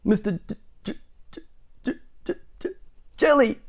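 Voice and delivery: frightened stammer, high burst on Jelly. A narrator's reading. Mister Jelly stammers his name to the tramp, trailing whispers then high burst. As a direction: stutter the J, then blurt the name. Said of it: "stammered Mister Jelly".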